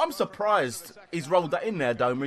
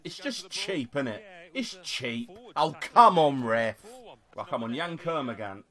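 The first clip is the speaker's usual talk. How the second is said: ordinary talk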